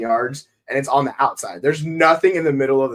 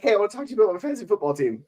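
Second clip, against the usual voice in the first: quick, higher eager chatter; chatty nurse imitation; 8 semitones higher, 4 dB quieter, much faster than usual. Imitating a nurse interrupting him about fantasy football, fast and higher. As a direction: rattle it off like an eager coworker. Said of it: "the one nurse that was talking to me yesterday was like".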